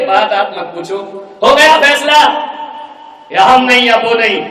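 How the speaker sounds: loud, high final ultimatum; furious verdict. Acted. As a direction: slam down the decision. Daughter declares decision.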